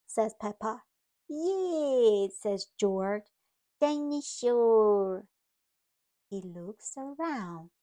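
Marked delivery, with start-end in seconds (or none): 1.3-2.3 louder, higher yay; little cheer. Acted. George cheering with family, excited yay. 3.8-5.3 put-on louder, slow little-boy word; toddler voice. George asking for his toy, slow toddler call.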